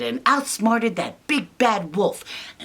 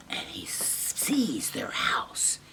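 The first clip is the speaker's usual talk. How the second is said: slow hushed whisper; slow suspense whisper; 8 semitones lower, 9 dB quieter, much slower than usual. A narrator's reading. Storyteller to children, slow whisper. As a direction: draw the kids in, whispering slowly. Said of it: "storyteller whispers".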